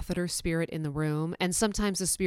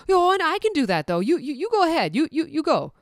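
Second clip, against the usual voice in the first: high, put-on voice of a pushy coworker; mock-insistent helper voice; 10 semitones higher, 3 dB louder than usual. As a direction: imitate a friend shooing her away. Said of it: "Jen quote continues".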